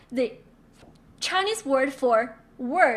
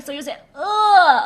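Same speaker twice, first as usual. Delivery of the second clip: uh pushed out loud like a blow to the stomach; punched grunt; 4 semitones higher, 10 dB louder than usual. Shown as a demonstration. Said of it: "like someone punching you in the stomach".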